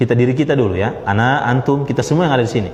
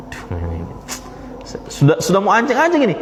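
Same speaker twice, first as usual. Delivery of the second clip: mock flustered anger; 5 semitones higher, faster than usual. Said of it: "Nah, mau marah".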